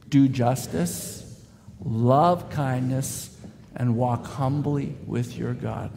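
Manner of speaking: slow and deliberate, word by word; slow unison recitation. Shown as a demonstration. Pastor leads the church in reciting the verse, slow and deliberate. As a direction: lead the congregation through it, very slow. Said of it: "would you say this with, with me?".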